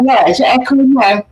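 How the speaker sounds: usual speaking voice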